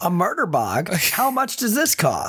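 hammed-up curious crook; eager criminal customer. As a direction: play a keen, gullible customer.

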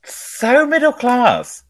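high, slow snooty drawl; a jab at the cheap ice cream in a high, slow posh voice; mock posh voice. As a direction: sneer it like a toffee-nosed aristocrat.

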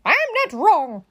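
high, loud denial; storybook cross yell; indignant shout. A narrator's reading. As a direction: snap back defensively. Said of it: "Eugenia shouted".

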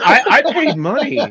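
high, slow, laughing protest; mock baffled complaint. Friend who paid for the photo op, high mock protest. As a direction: whine it through laughter.

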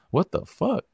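high, baffled what the fuck; disbelieving. Real, not acted. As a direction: sound baffled.

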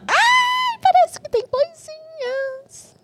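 very high, loud, drawn-out squeal; excited squeal. Real, not acted. Host cuts off the answer as snacks arrive, excited squeal. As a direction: squeal it high and stretch out the last word.

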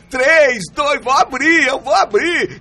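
excited countdown. Pretend. Acting out opening a present.

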